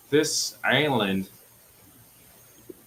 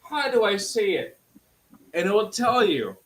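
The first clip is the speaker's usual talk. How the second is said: heated; 9 semitones higher than usual. Real, not acted. Heated lecture about pronunciation.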